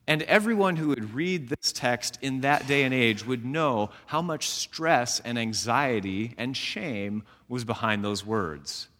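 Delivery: ordinary talk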